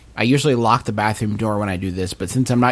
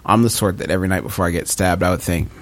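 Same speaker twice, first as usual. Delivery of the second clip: ordinary talk, nothing marked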